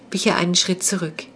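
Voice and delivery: usual speaking voice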